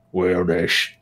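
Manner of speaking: lightly voiced orc. Shown as a demonstration. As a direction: try out an orc farmer. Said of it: "how do you do an orc".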